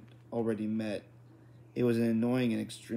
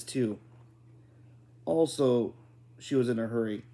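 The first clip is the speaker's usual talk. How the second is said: plain reading voice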